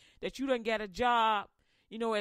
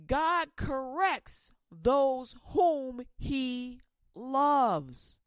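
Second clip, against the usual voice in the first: preaching emphasis; 4 dB louder, much slower than usual. Acted.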